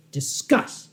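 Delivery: Discuss whispered with weight on the first syllable; stress on discuss. Shown as a demonstration. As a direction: lean into Discuss. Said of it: "Yes, that, that emphasis".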